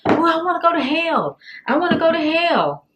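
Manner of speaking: high, fast, eager begging; excited whiny plea. Retold or imitated. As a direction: beg like an excited kid. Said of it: "I was the main one".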